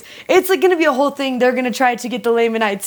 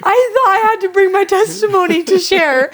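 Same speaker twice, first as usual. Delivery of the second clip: put-on little boy; 8 semitones higher, 4 dB louder than usual. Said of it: "And he's like".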